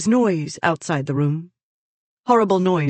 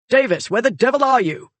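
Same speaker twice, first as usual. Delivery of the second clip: loud, higher call through the house; a demanding yell up the stairs; impatient shout; 7 semitones higher, 5 dB louder than usual. Acted. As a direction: bellow for the missing maid. Radford calls out for the maid.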